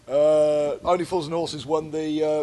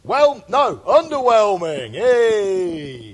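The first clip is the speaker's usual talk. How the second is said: high, loud game-show announce; mock cheer; 5 semitones higher, 7 dB louder, much slower than usual. Announcing the celebrity verdict to the listener, mock cheer. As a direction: proclaim the verdict like a host.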